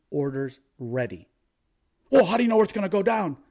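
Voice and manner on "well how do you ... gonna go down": higher, louder, fast whiny questioner; mocking peasant. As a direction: parody the clueless peasant asking, quick and high. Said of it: "That's the question of a fucking peasant".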